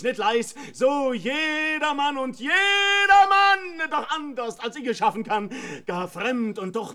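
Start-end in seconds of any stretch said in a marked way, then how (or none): 0.7-3.9 loud, slow, sung-out ghostly call; drawn-out haunting summons; eerie calling voice. Retold or imitated. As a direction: imitate the dreadful voice calling his name. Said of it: "in fürchterlicher Weis und laut und mächtiglich".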